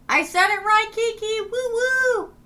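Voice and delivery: high, loud triumphant cheer; gleeful whoop. Real, not acted. Streamer celebrating saying a viewer's name right, gleeful whoop. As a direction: whoop it out on a high held pitch.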